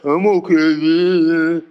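slow, low and drawn out, deadpan; bored flat I'm okay. Retold or imitated. Preacher mocking dull churchgoers, slow low deadpan. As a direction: drag it out like nothing excites you. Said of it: "Some of you like".

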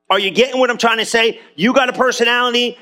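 ordinary talk

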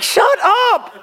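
high, laughing mock whine; whiny protest. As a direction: whine it back at him with a laugh. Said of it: "I'm like".

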